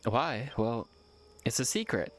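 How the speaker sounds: normal talking voice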